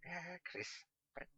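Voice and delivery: a lightly voiced old wizard drilling the words into memory, slowing at the end; muttered repeating. A narrator's reading. Catweasel repeating the boy's order on the way to the pub. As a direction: chant it over and over so as not to forget. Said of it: "endlessly repeating".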